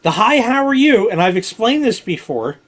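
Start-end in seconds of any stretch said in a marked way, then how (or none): 0.1-1.1 chirpy waitress greeting. Retold or imitated. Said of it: "is perfect, plain, everyday English".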